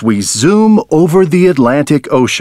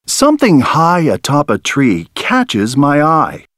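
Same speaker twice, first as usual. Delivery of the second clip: plain reading voice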